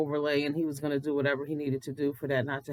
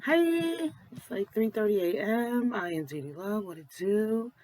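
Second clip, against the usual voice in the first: just-woke-up morning voice, rough and sleepy; groggy morning voice; 3 semitones higher, slower than usual. Real, not acted. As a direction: talk like you rolled out of bed. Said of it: "this is my mid-morning voice".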